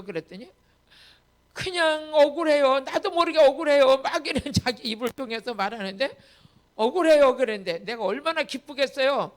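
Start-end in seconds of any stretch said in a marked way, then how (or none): 1.5-4.0 imitated tearful complaint. Pastor imitating the weeping woman's grievance, loud lament. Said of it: "왜 울어요?". 6.7-7.4 loud mock lament; imitated tearful complaint. Pastor echoing the weeping woman's grievance, loud lament. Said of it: "왜 울어요?".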